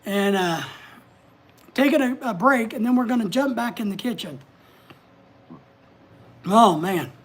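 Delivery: winded, catching breath. Real, not acted. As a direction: breathe between words. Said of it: "I'm trying to catch my breath".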